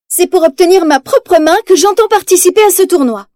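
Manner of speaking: high, loud defiant announcement; bold declaration. Acted. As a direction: claim her own hand proudly. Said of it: "annonce-t-elle".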